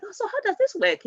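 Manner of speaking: high-pitched inquisitive voice; imitated curious learner. Acts out a newcomer asking questions.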